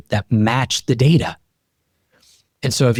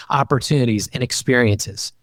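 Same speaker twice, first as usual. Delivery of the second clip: usual speaking voice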